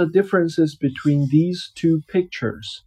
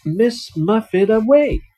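lively picture-book reading voice; reading to a child; 6 semitones higher than usual. Acted. Dad reading a picture book to his child, storytelling voice. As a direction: read it out like a bedtime story for a little one.

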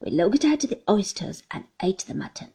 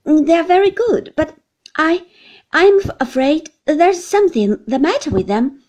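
put-on higher, louder, breathless girlish chatter; eager young wife; 7 semitones higher, 8 dB louder than usual. A narrator's reading. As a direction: tumble through the explanation, worried. Dora explains the oysters.

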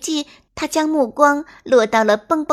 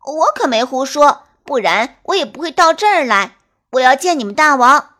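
louder, urgent brave rabbit cry; shouting; 3 semitones higher, 7 dB louder than usual. Acted. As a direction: stand up to the wolves loudly. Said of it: "蹦蹦兔叫道".

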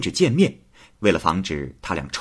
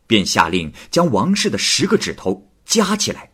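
plain reading voice

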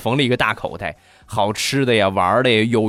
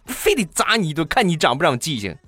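mom yelling; 8 semitones higher than usual. Retold or imitated. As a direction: yell at the kid like a mom counting every broken bowl. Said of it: "我妈在里边又大声地喊道".

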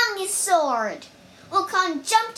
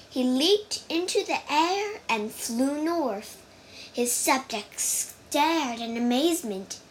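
ordinary delivery, nothing marked

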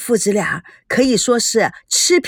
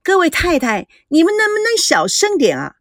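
high, raised voice telling them to hush; loud plea; 7 semitones higher than usual. A narrator's reading. Maid Qiumei hushing the noisy ladies, loudly. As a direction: call out over the chatter. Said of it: "秋妹大声地阻扰他们".

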